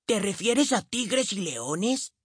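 put-on high, slow, wide-eyed little boy; young boy voice. A narrator's reading. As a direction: ask like a curious small child. Billy asks, boy voice.

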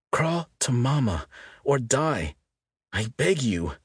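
slow, high, pleading; with feeling. A narrator's reading. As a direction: beg earnestly and slowly. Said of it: "said with feeling".

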